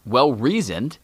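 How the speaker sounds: ordinary delivery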